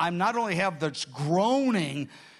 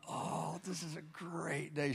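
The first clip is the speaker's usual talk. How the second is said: very soft, blissed-out murmur; hushed, exhausted happiness; wrung out but happy; 13 dB quieter, slower than usual. Retold or imitated. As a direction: sigh it out, spent and content. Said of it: "Wrung out, but happy".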